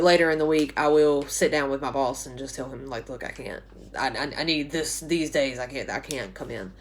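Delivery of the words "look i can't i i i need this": normal talking voice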